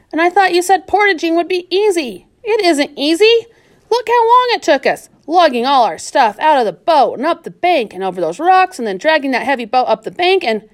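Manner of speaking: put-on whiny girl griping about the work; girl Florida's voice, complaining.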